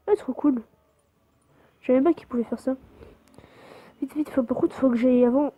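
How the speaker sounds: normal talking voice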